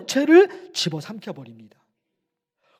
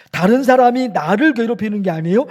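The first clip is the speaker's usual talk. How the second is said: usual speaking voice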